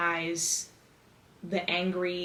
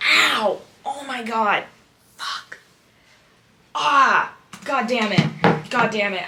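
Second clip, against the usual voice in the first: sudden pained yelps and curses; hit hard crying out; 4 semitones higher, 9 dB louder, slower than usual. Real, not acted. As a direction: cry out after banging your knee. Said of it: "I just hit my knee off the desk".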